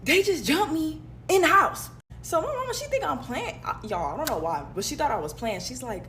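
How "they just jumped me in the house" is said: higher, louder, slow panicked yell; urgent yell. Retold or imitated. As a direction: yell it down the phone. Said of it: "I call her and I'm like".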